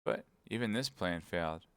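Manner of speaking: ordinary delivery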